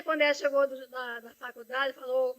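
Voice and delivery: normal talking voice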